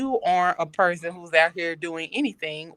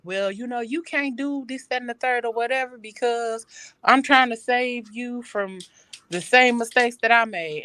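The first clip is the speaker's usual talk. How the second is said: higher-pitched mom voice; imitated mother; 6 semitones higher than usual. Mom explaining her rules to her child, imitated mother. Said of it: "my mom used to always be like".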